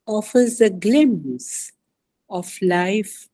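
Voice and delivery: slow, measured poem recital with pauses between phrases; poetry reading. Acted. Poet reading her poem Afterlife to fellow poets, measured recital. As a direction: read it line by line, letting each phrase fall. Reads own poem aloud.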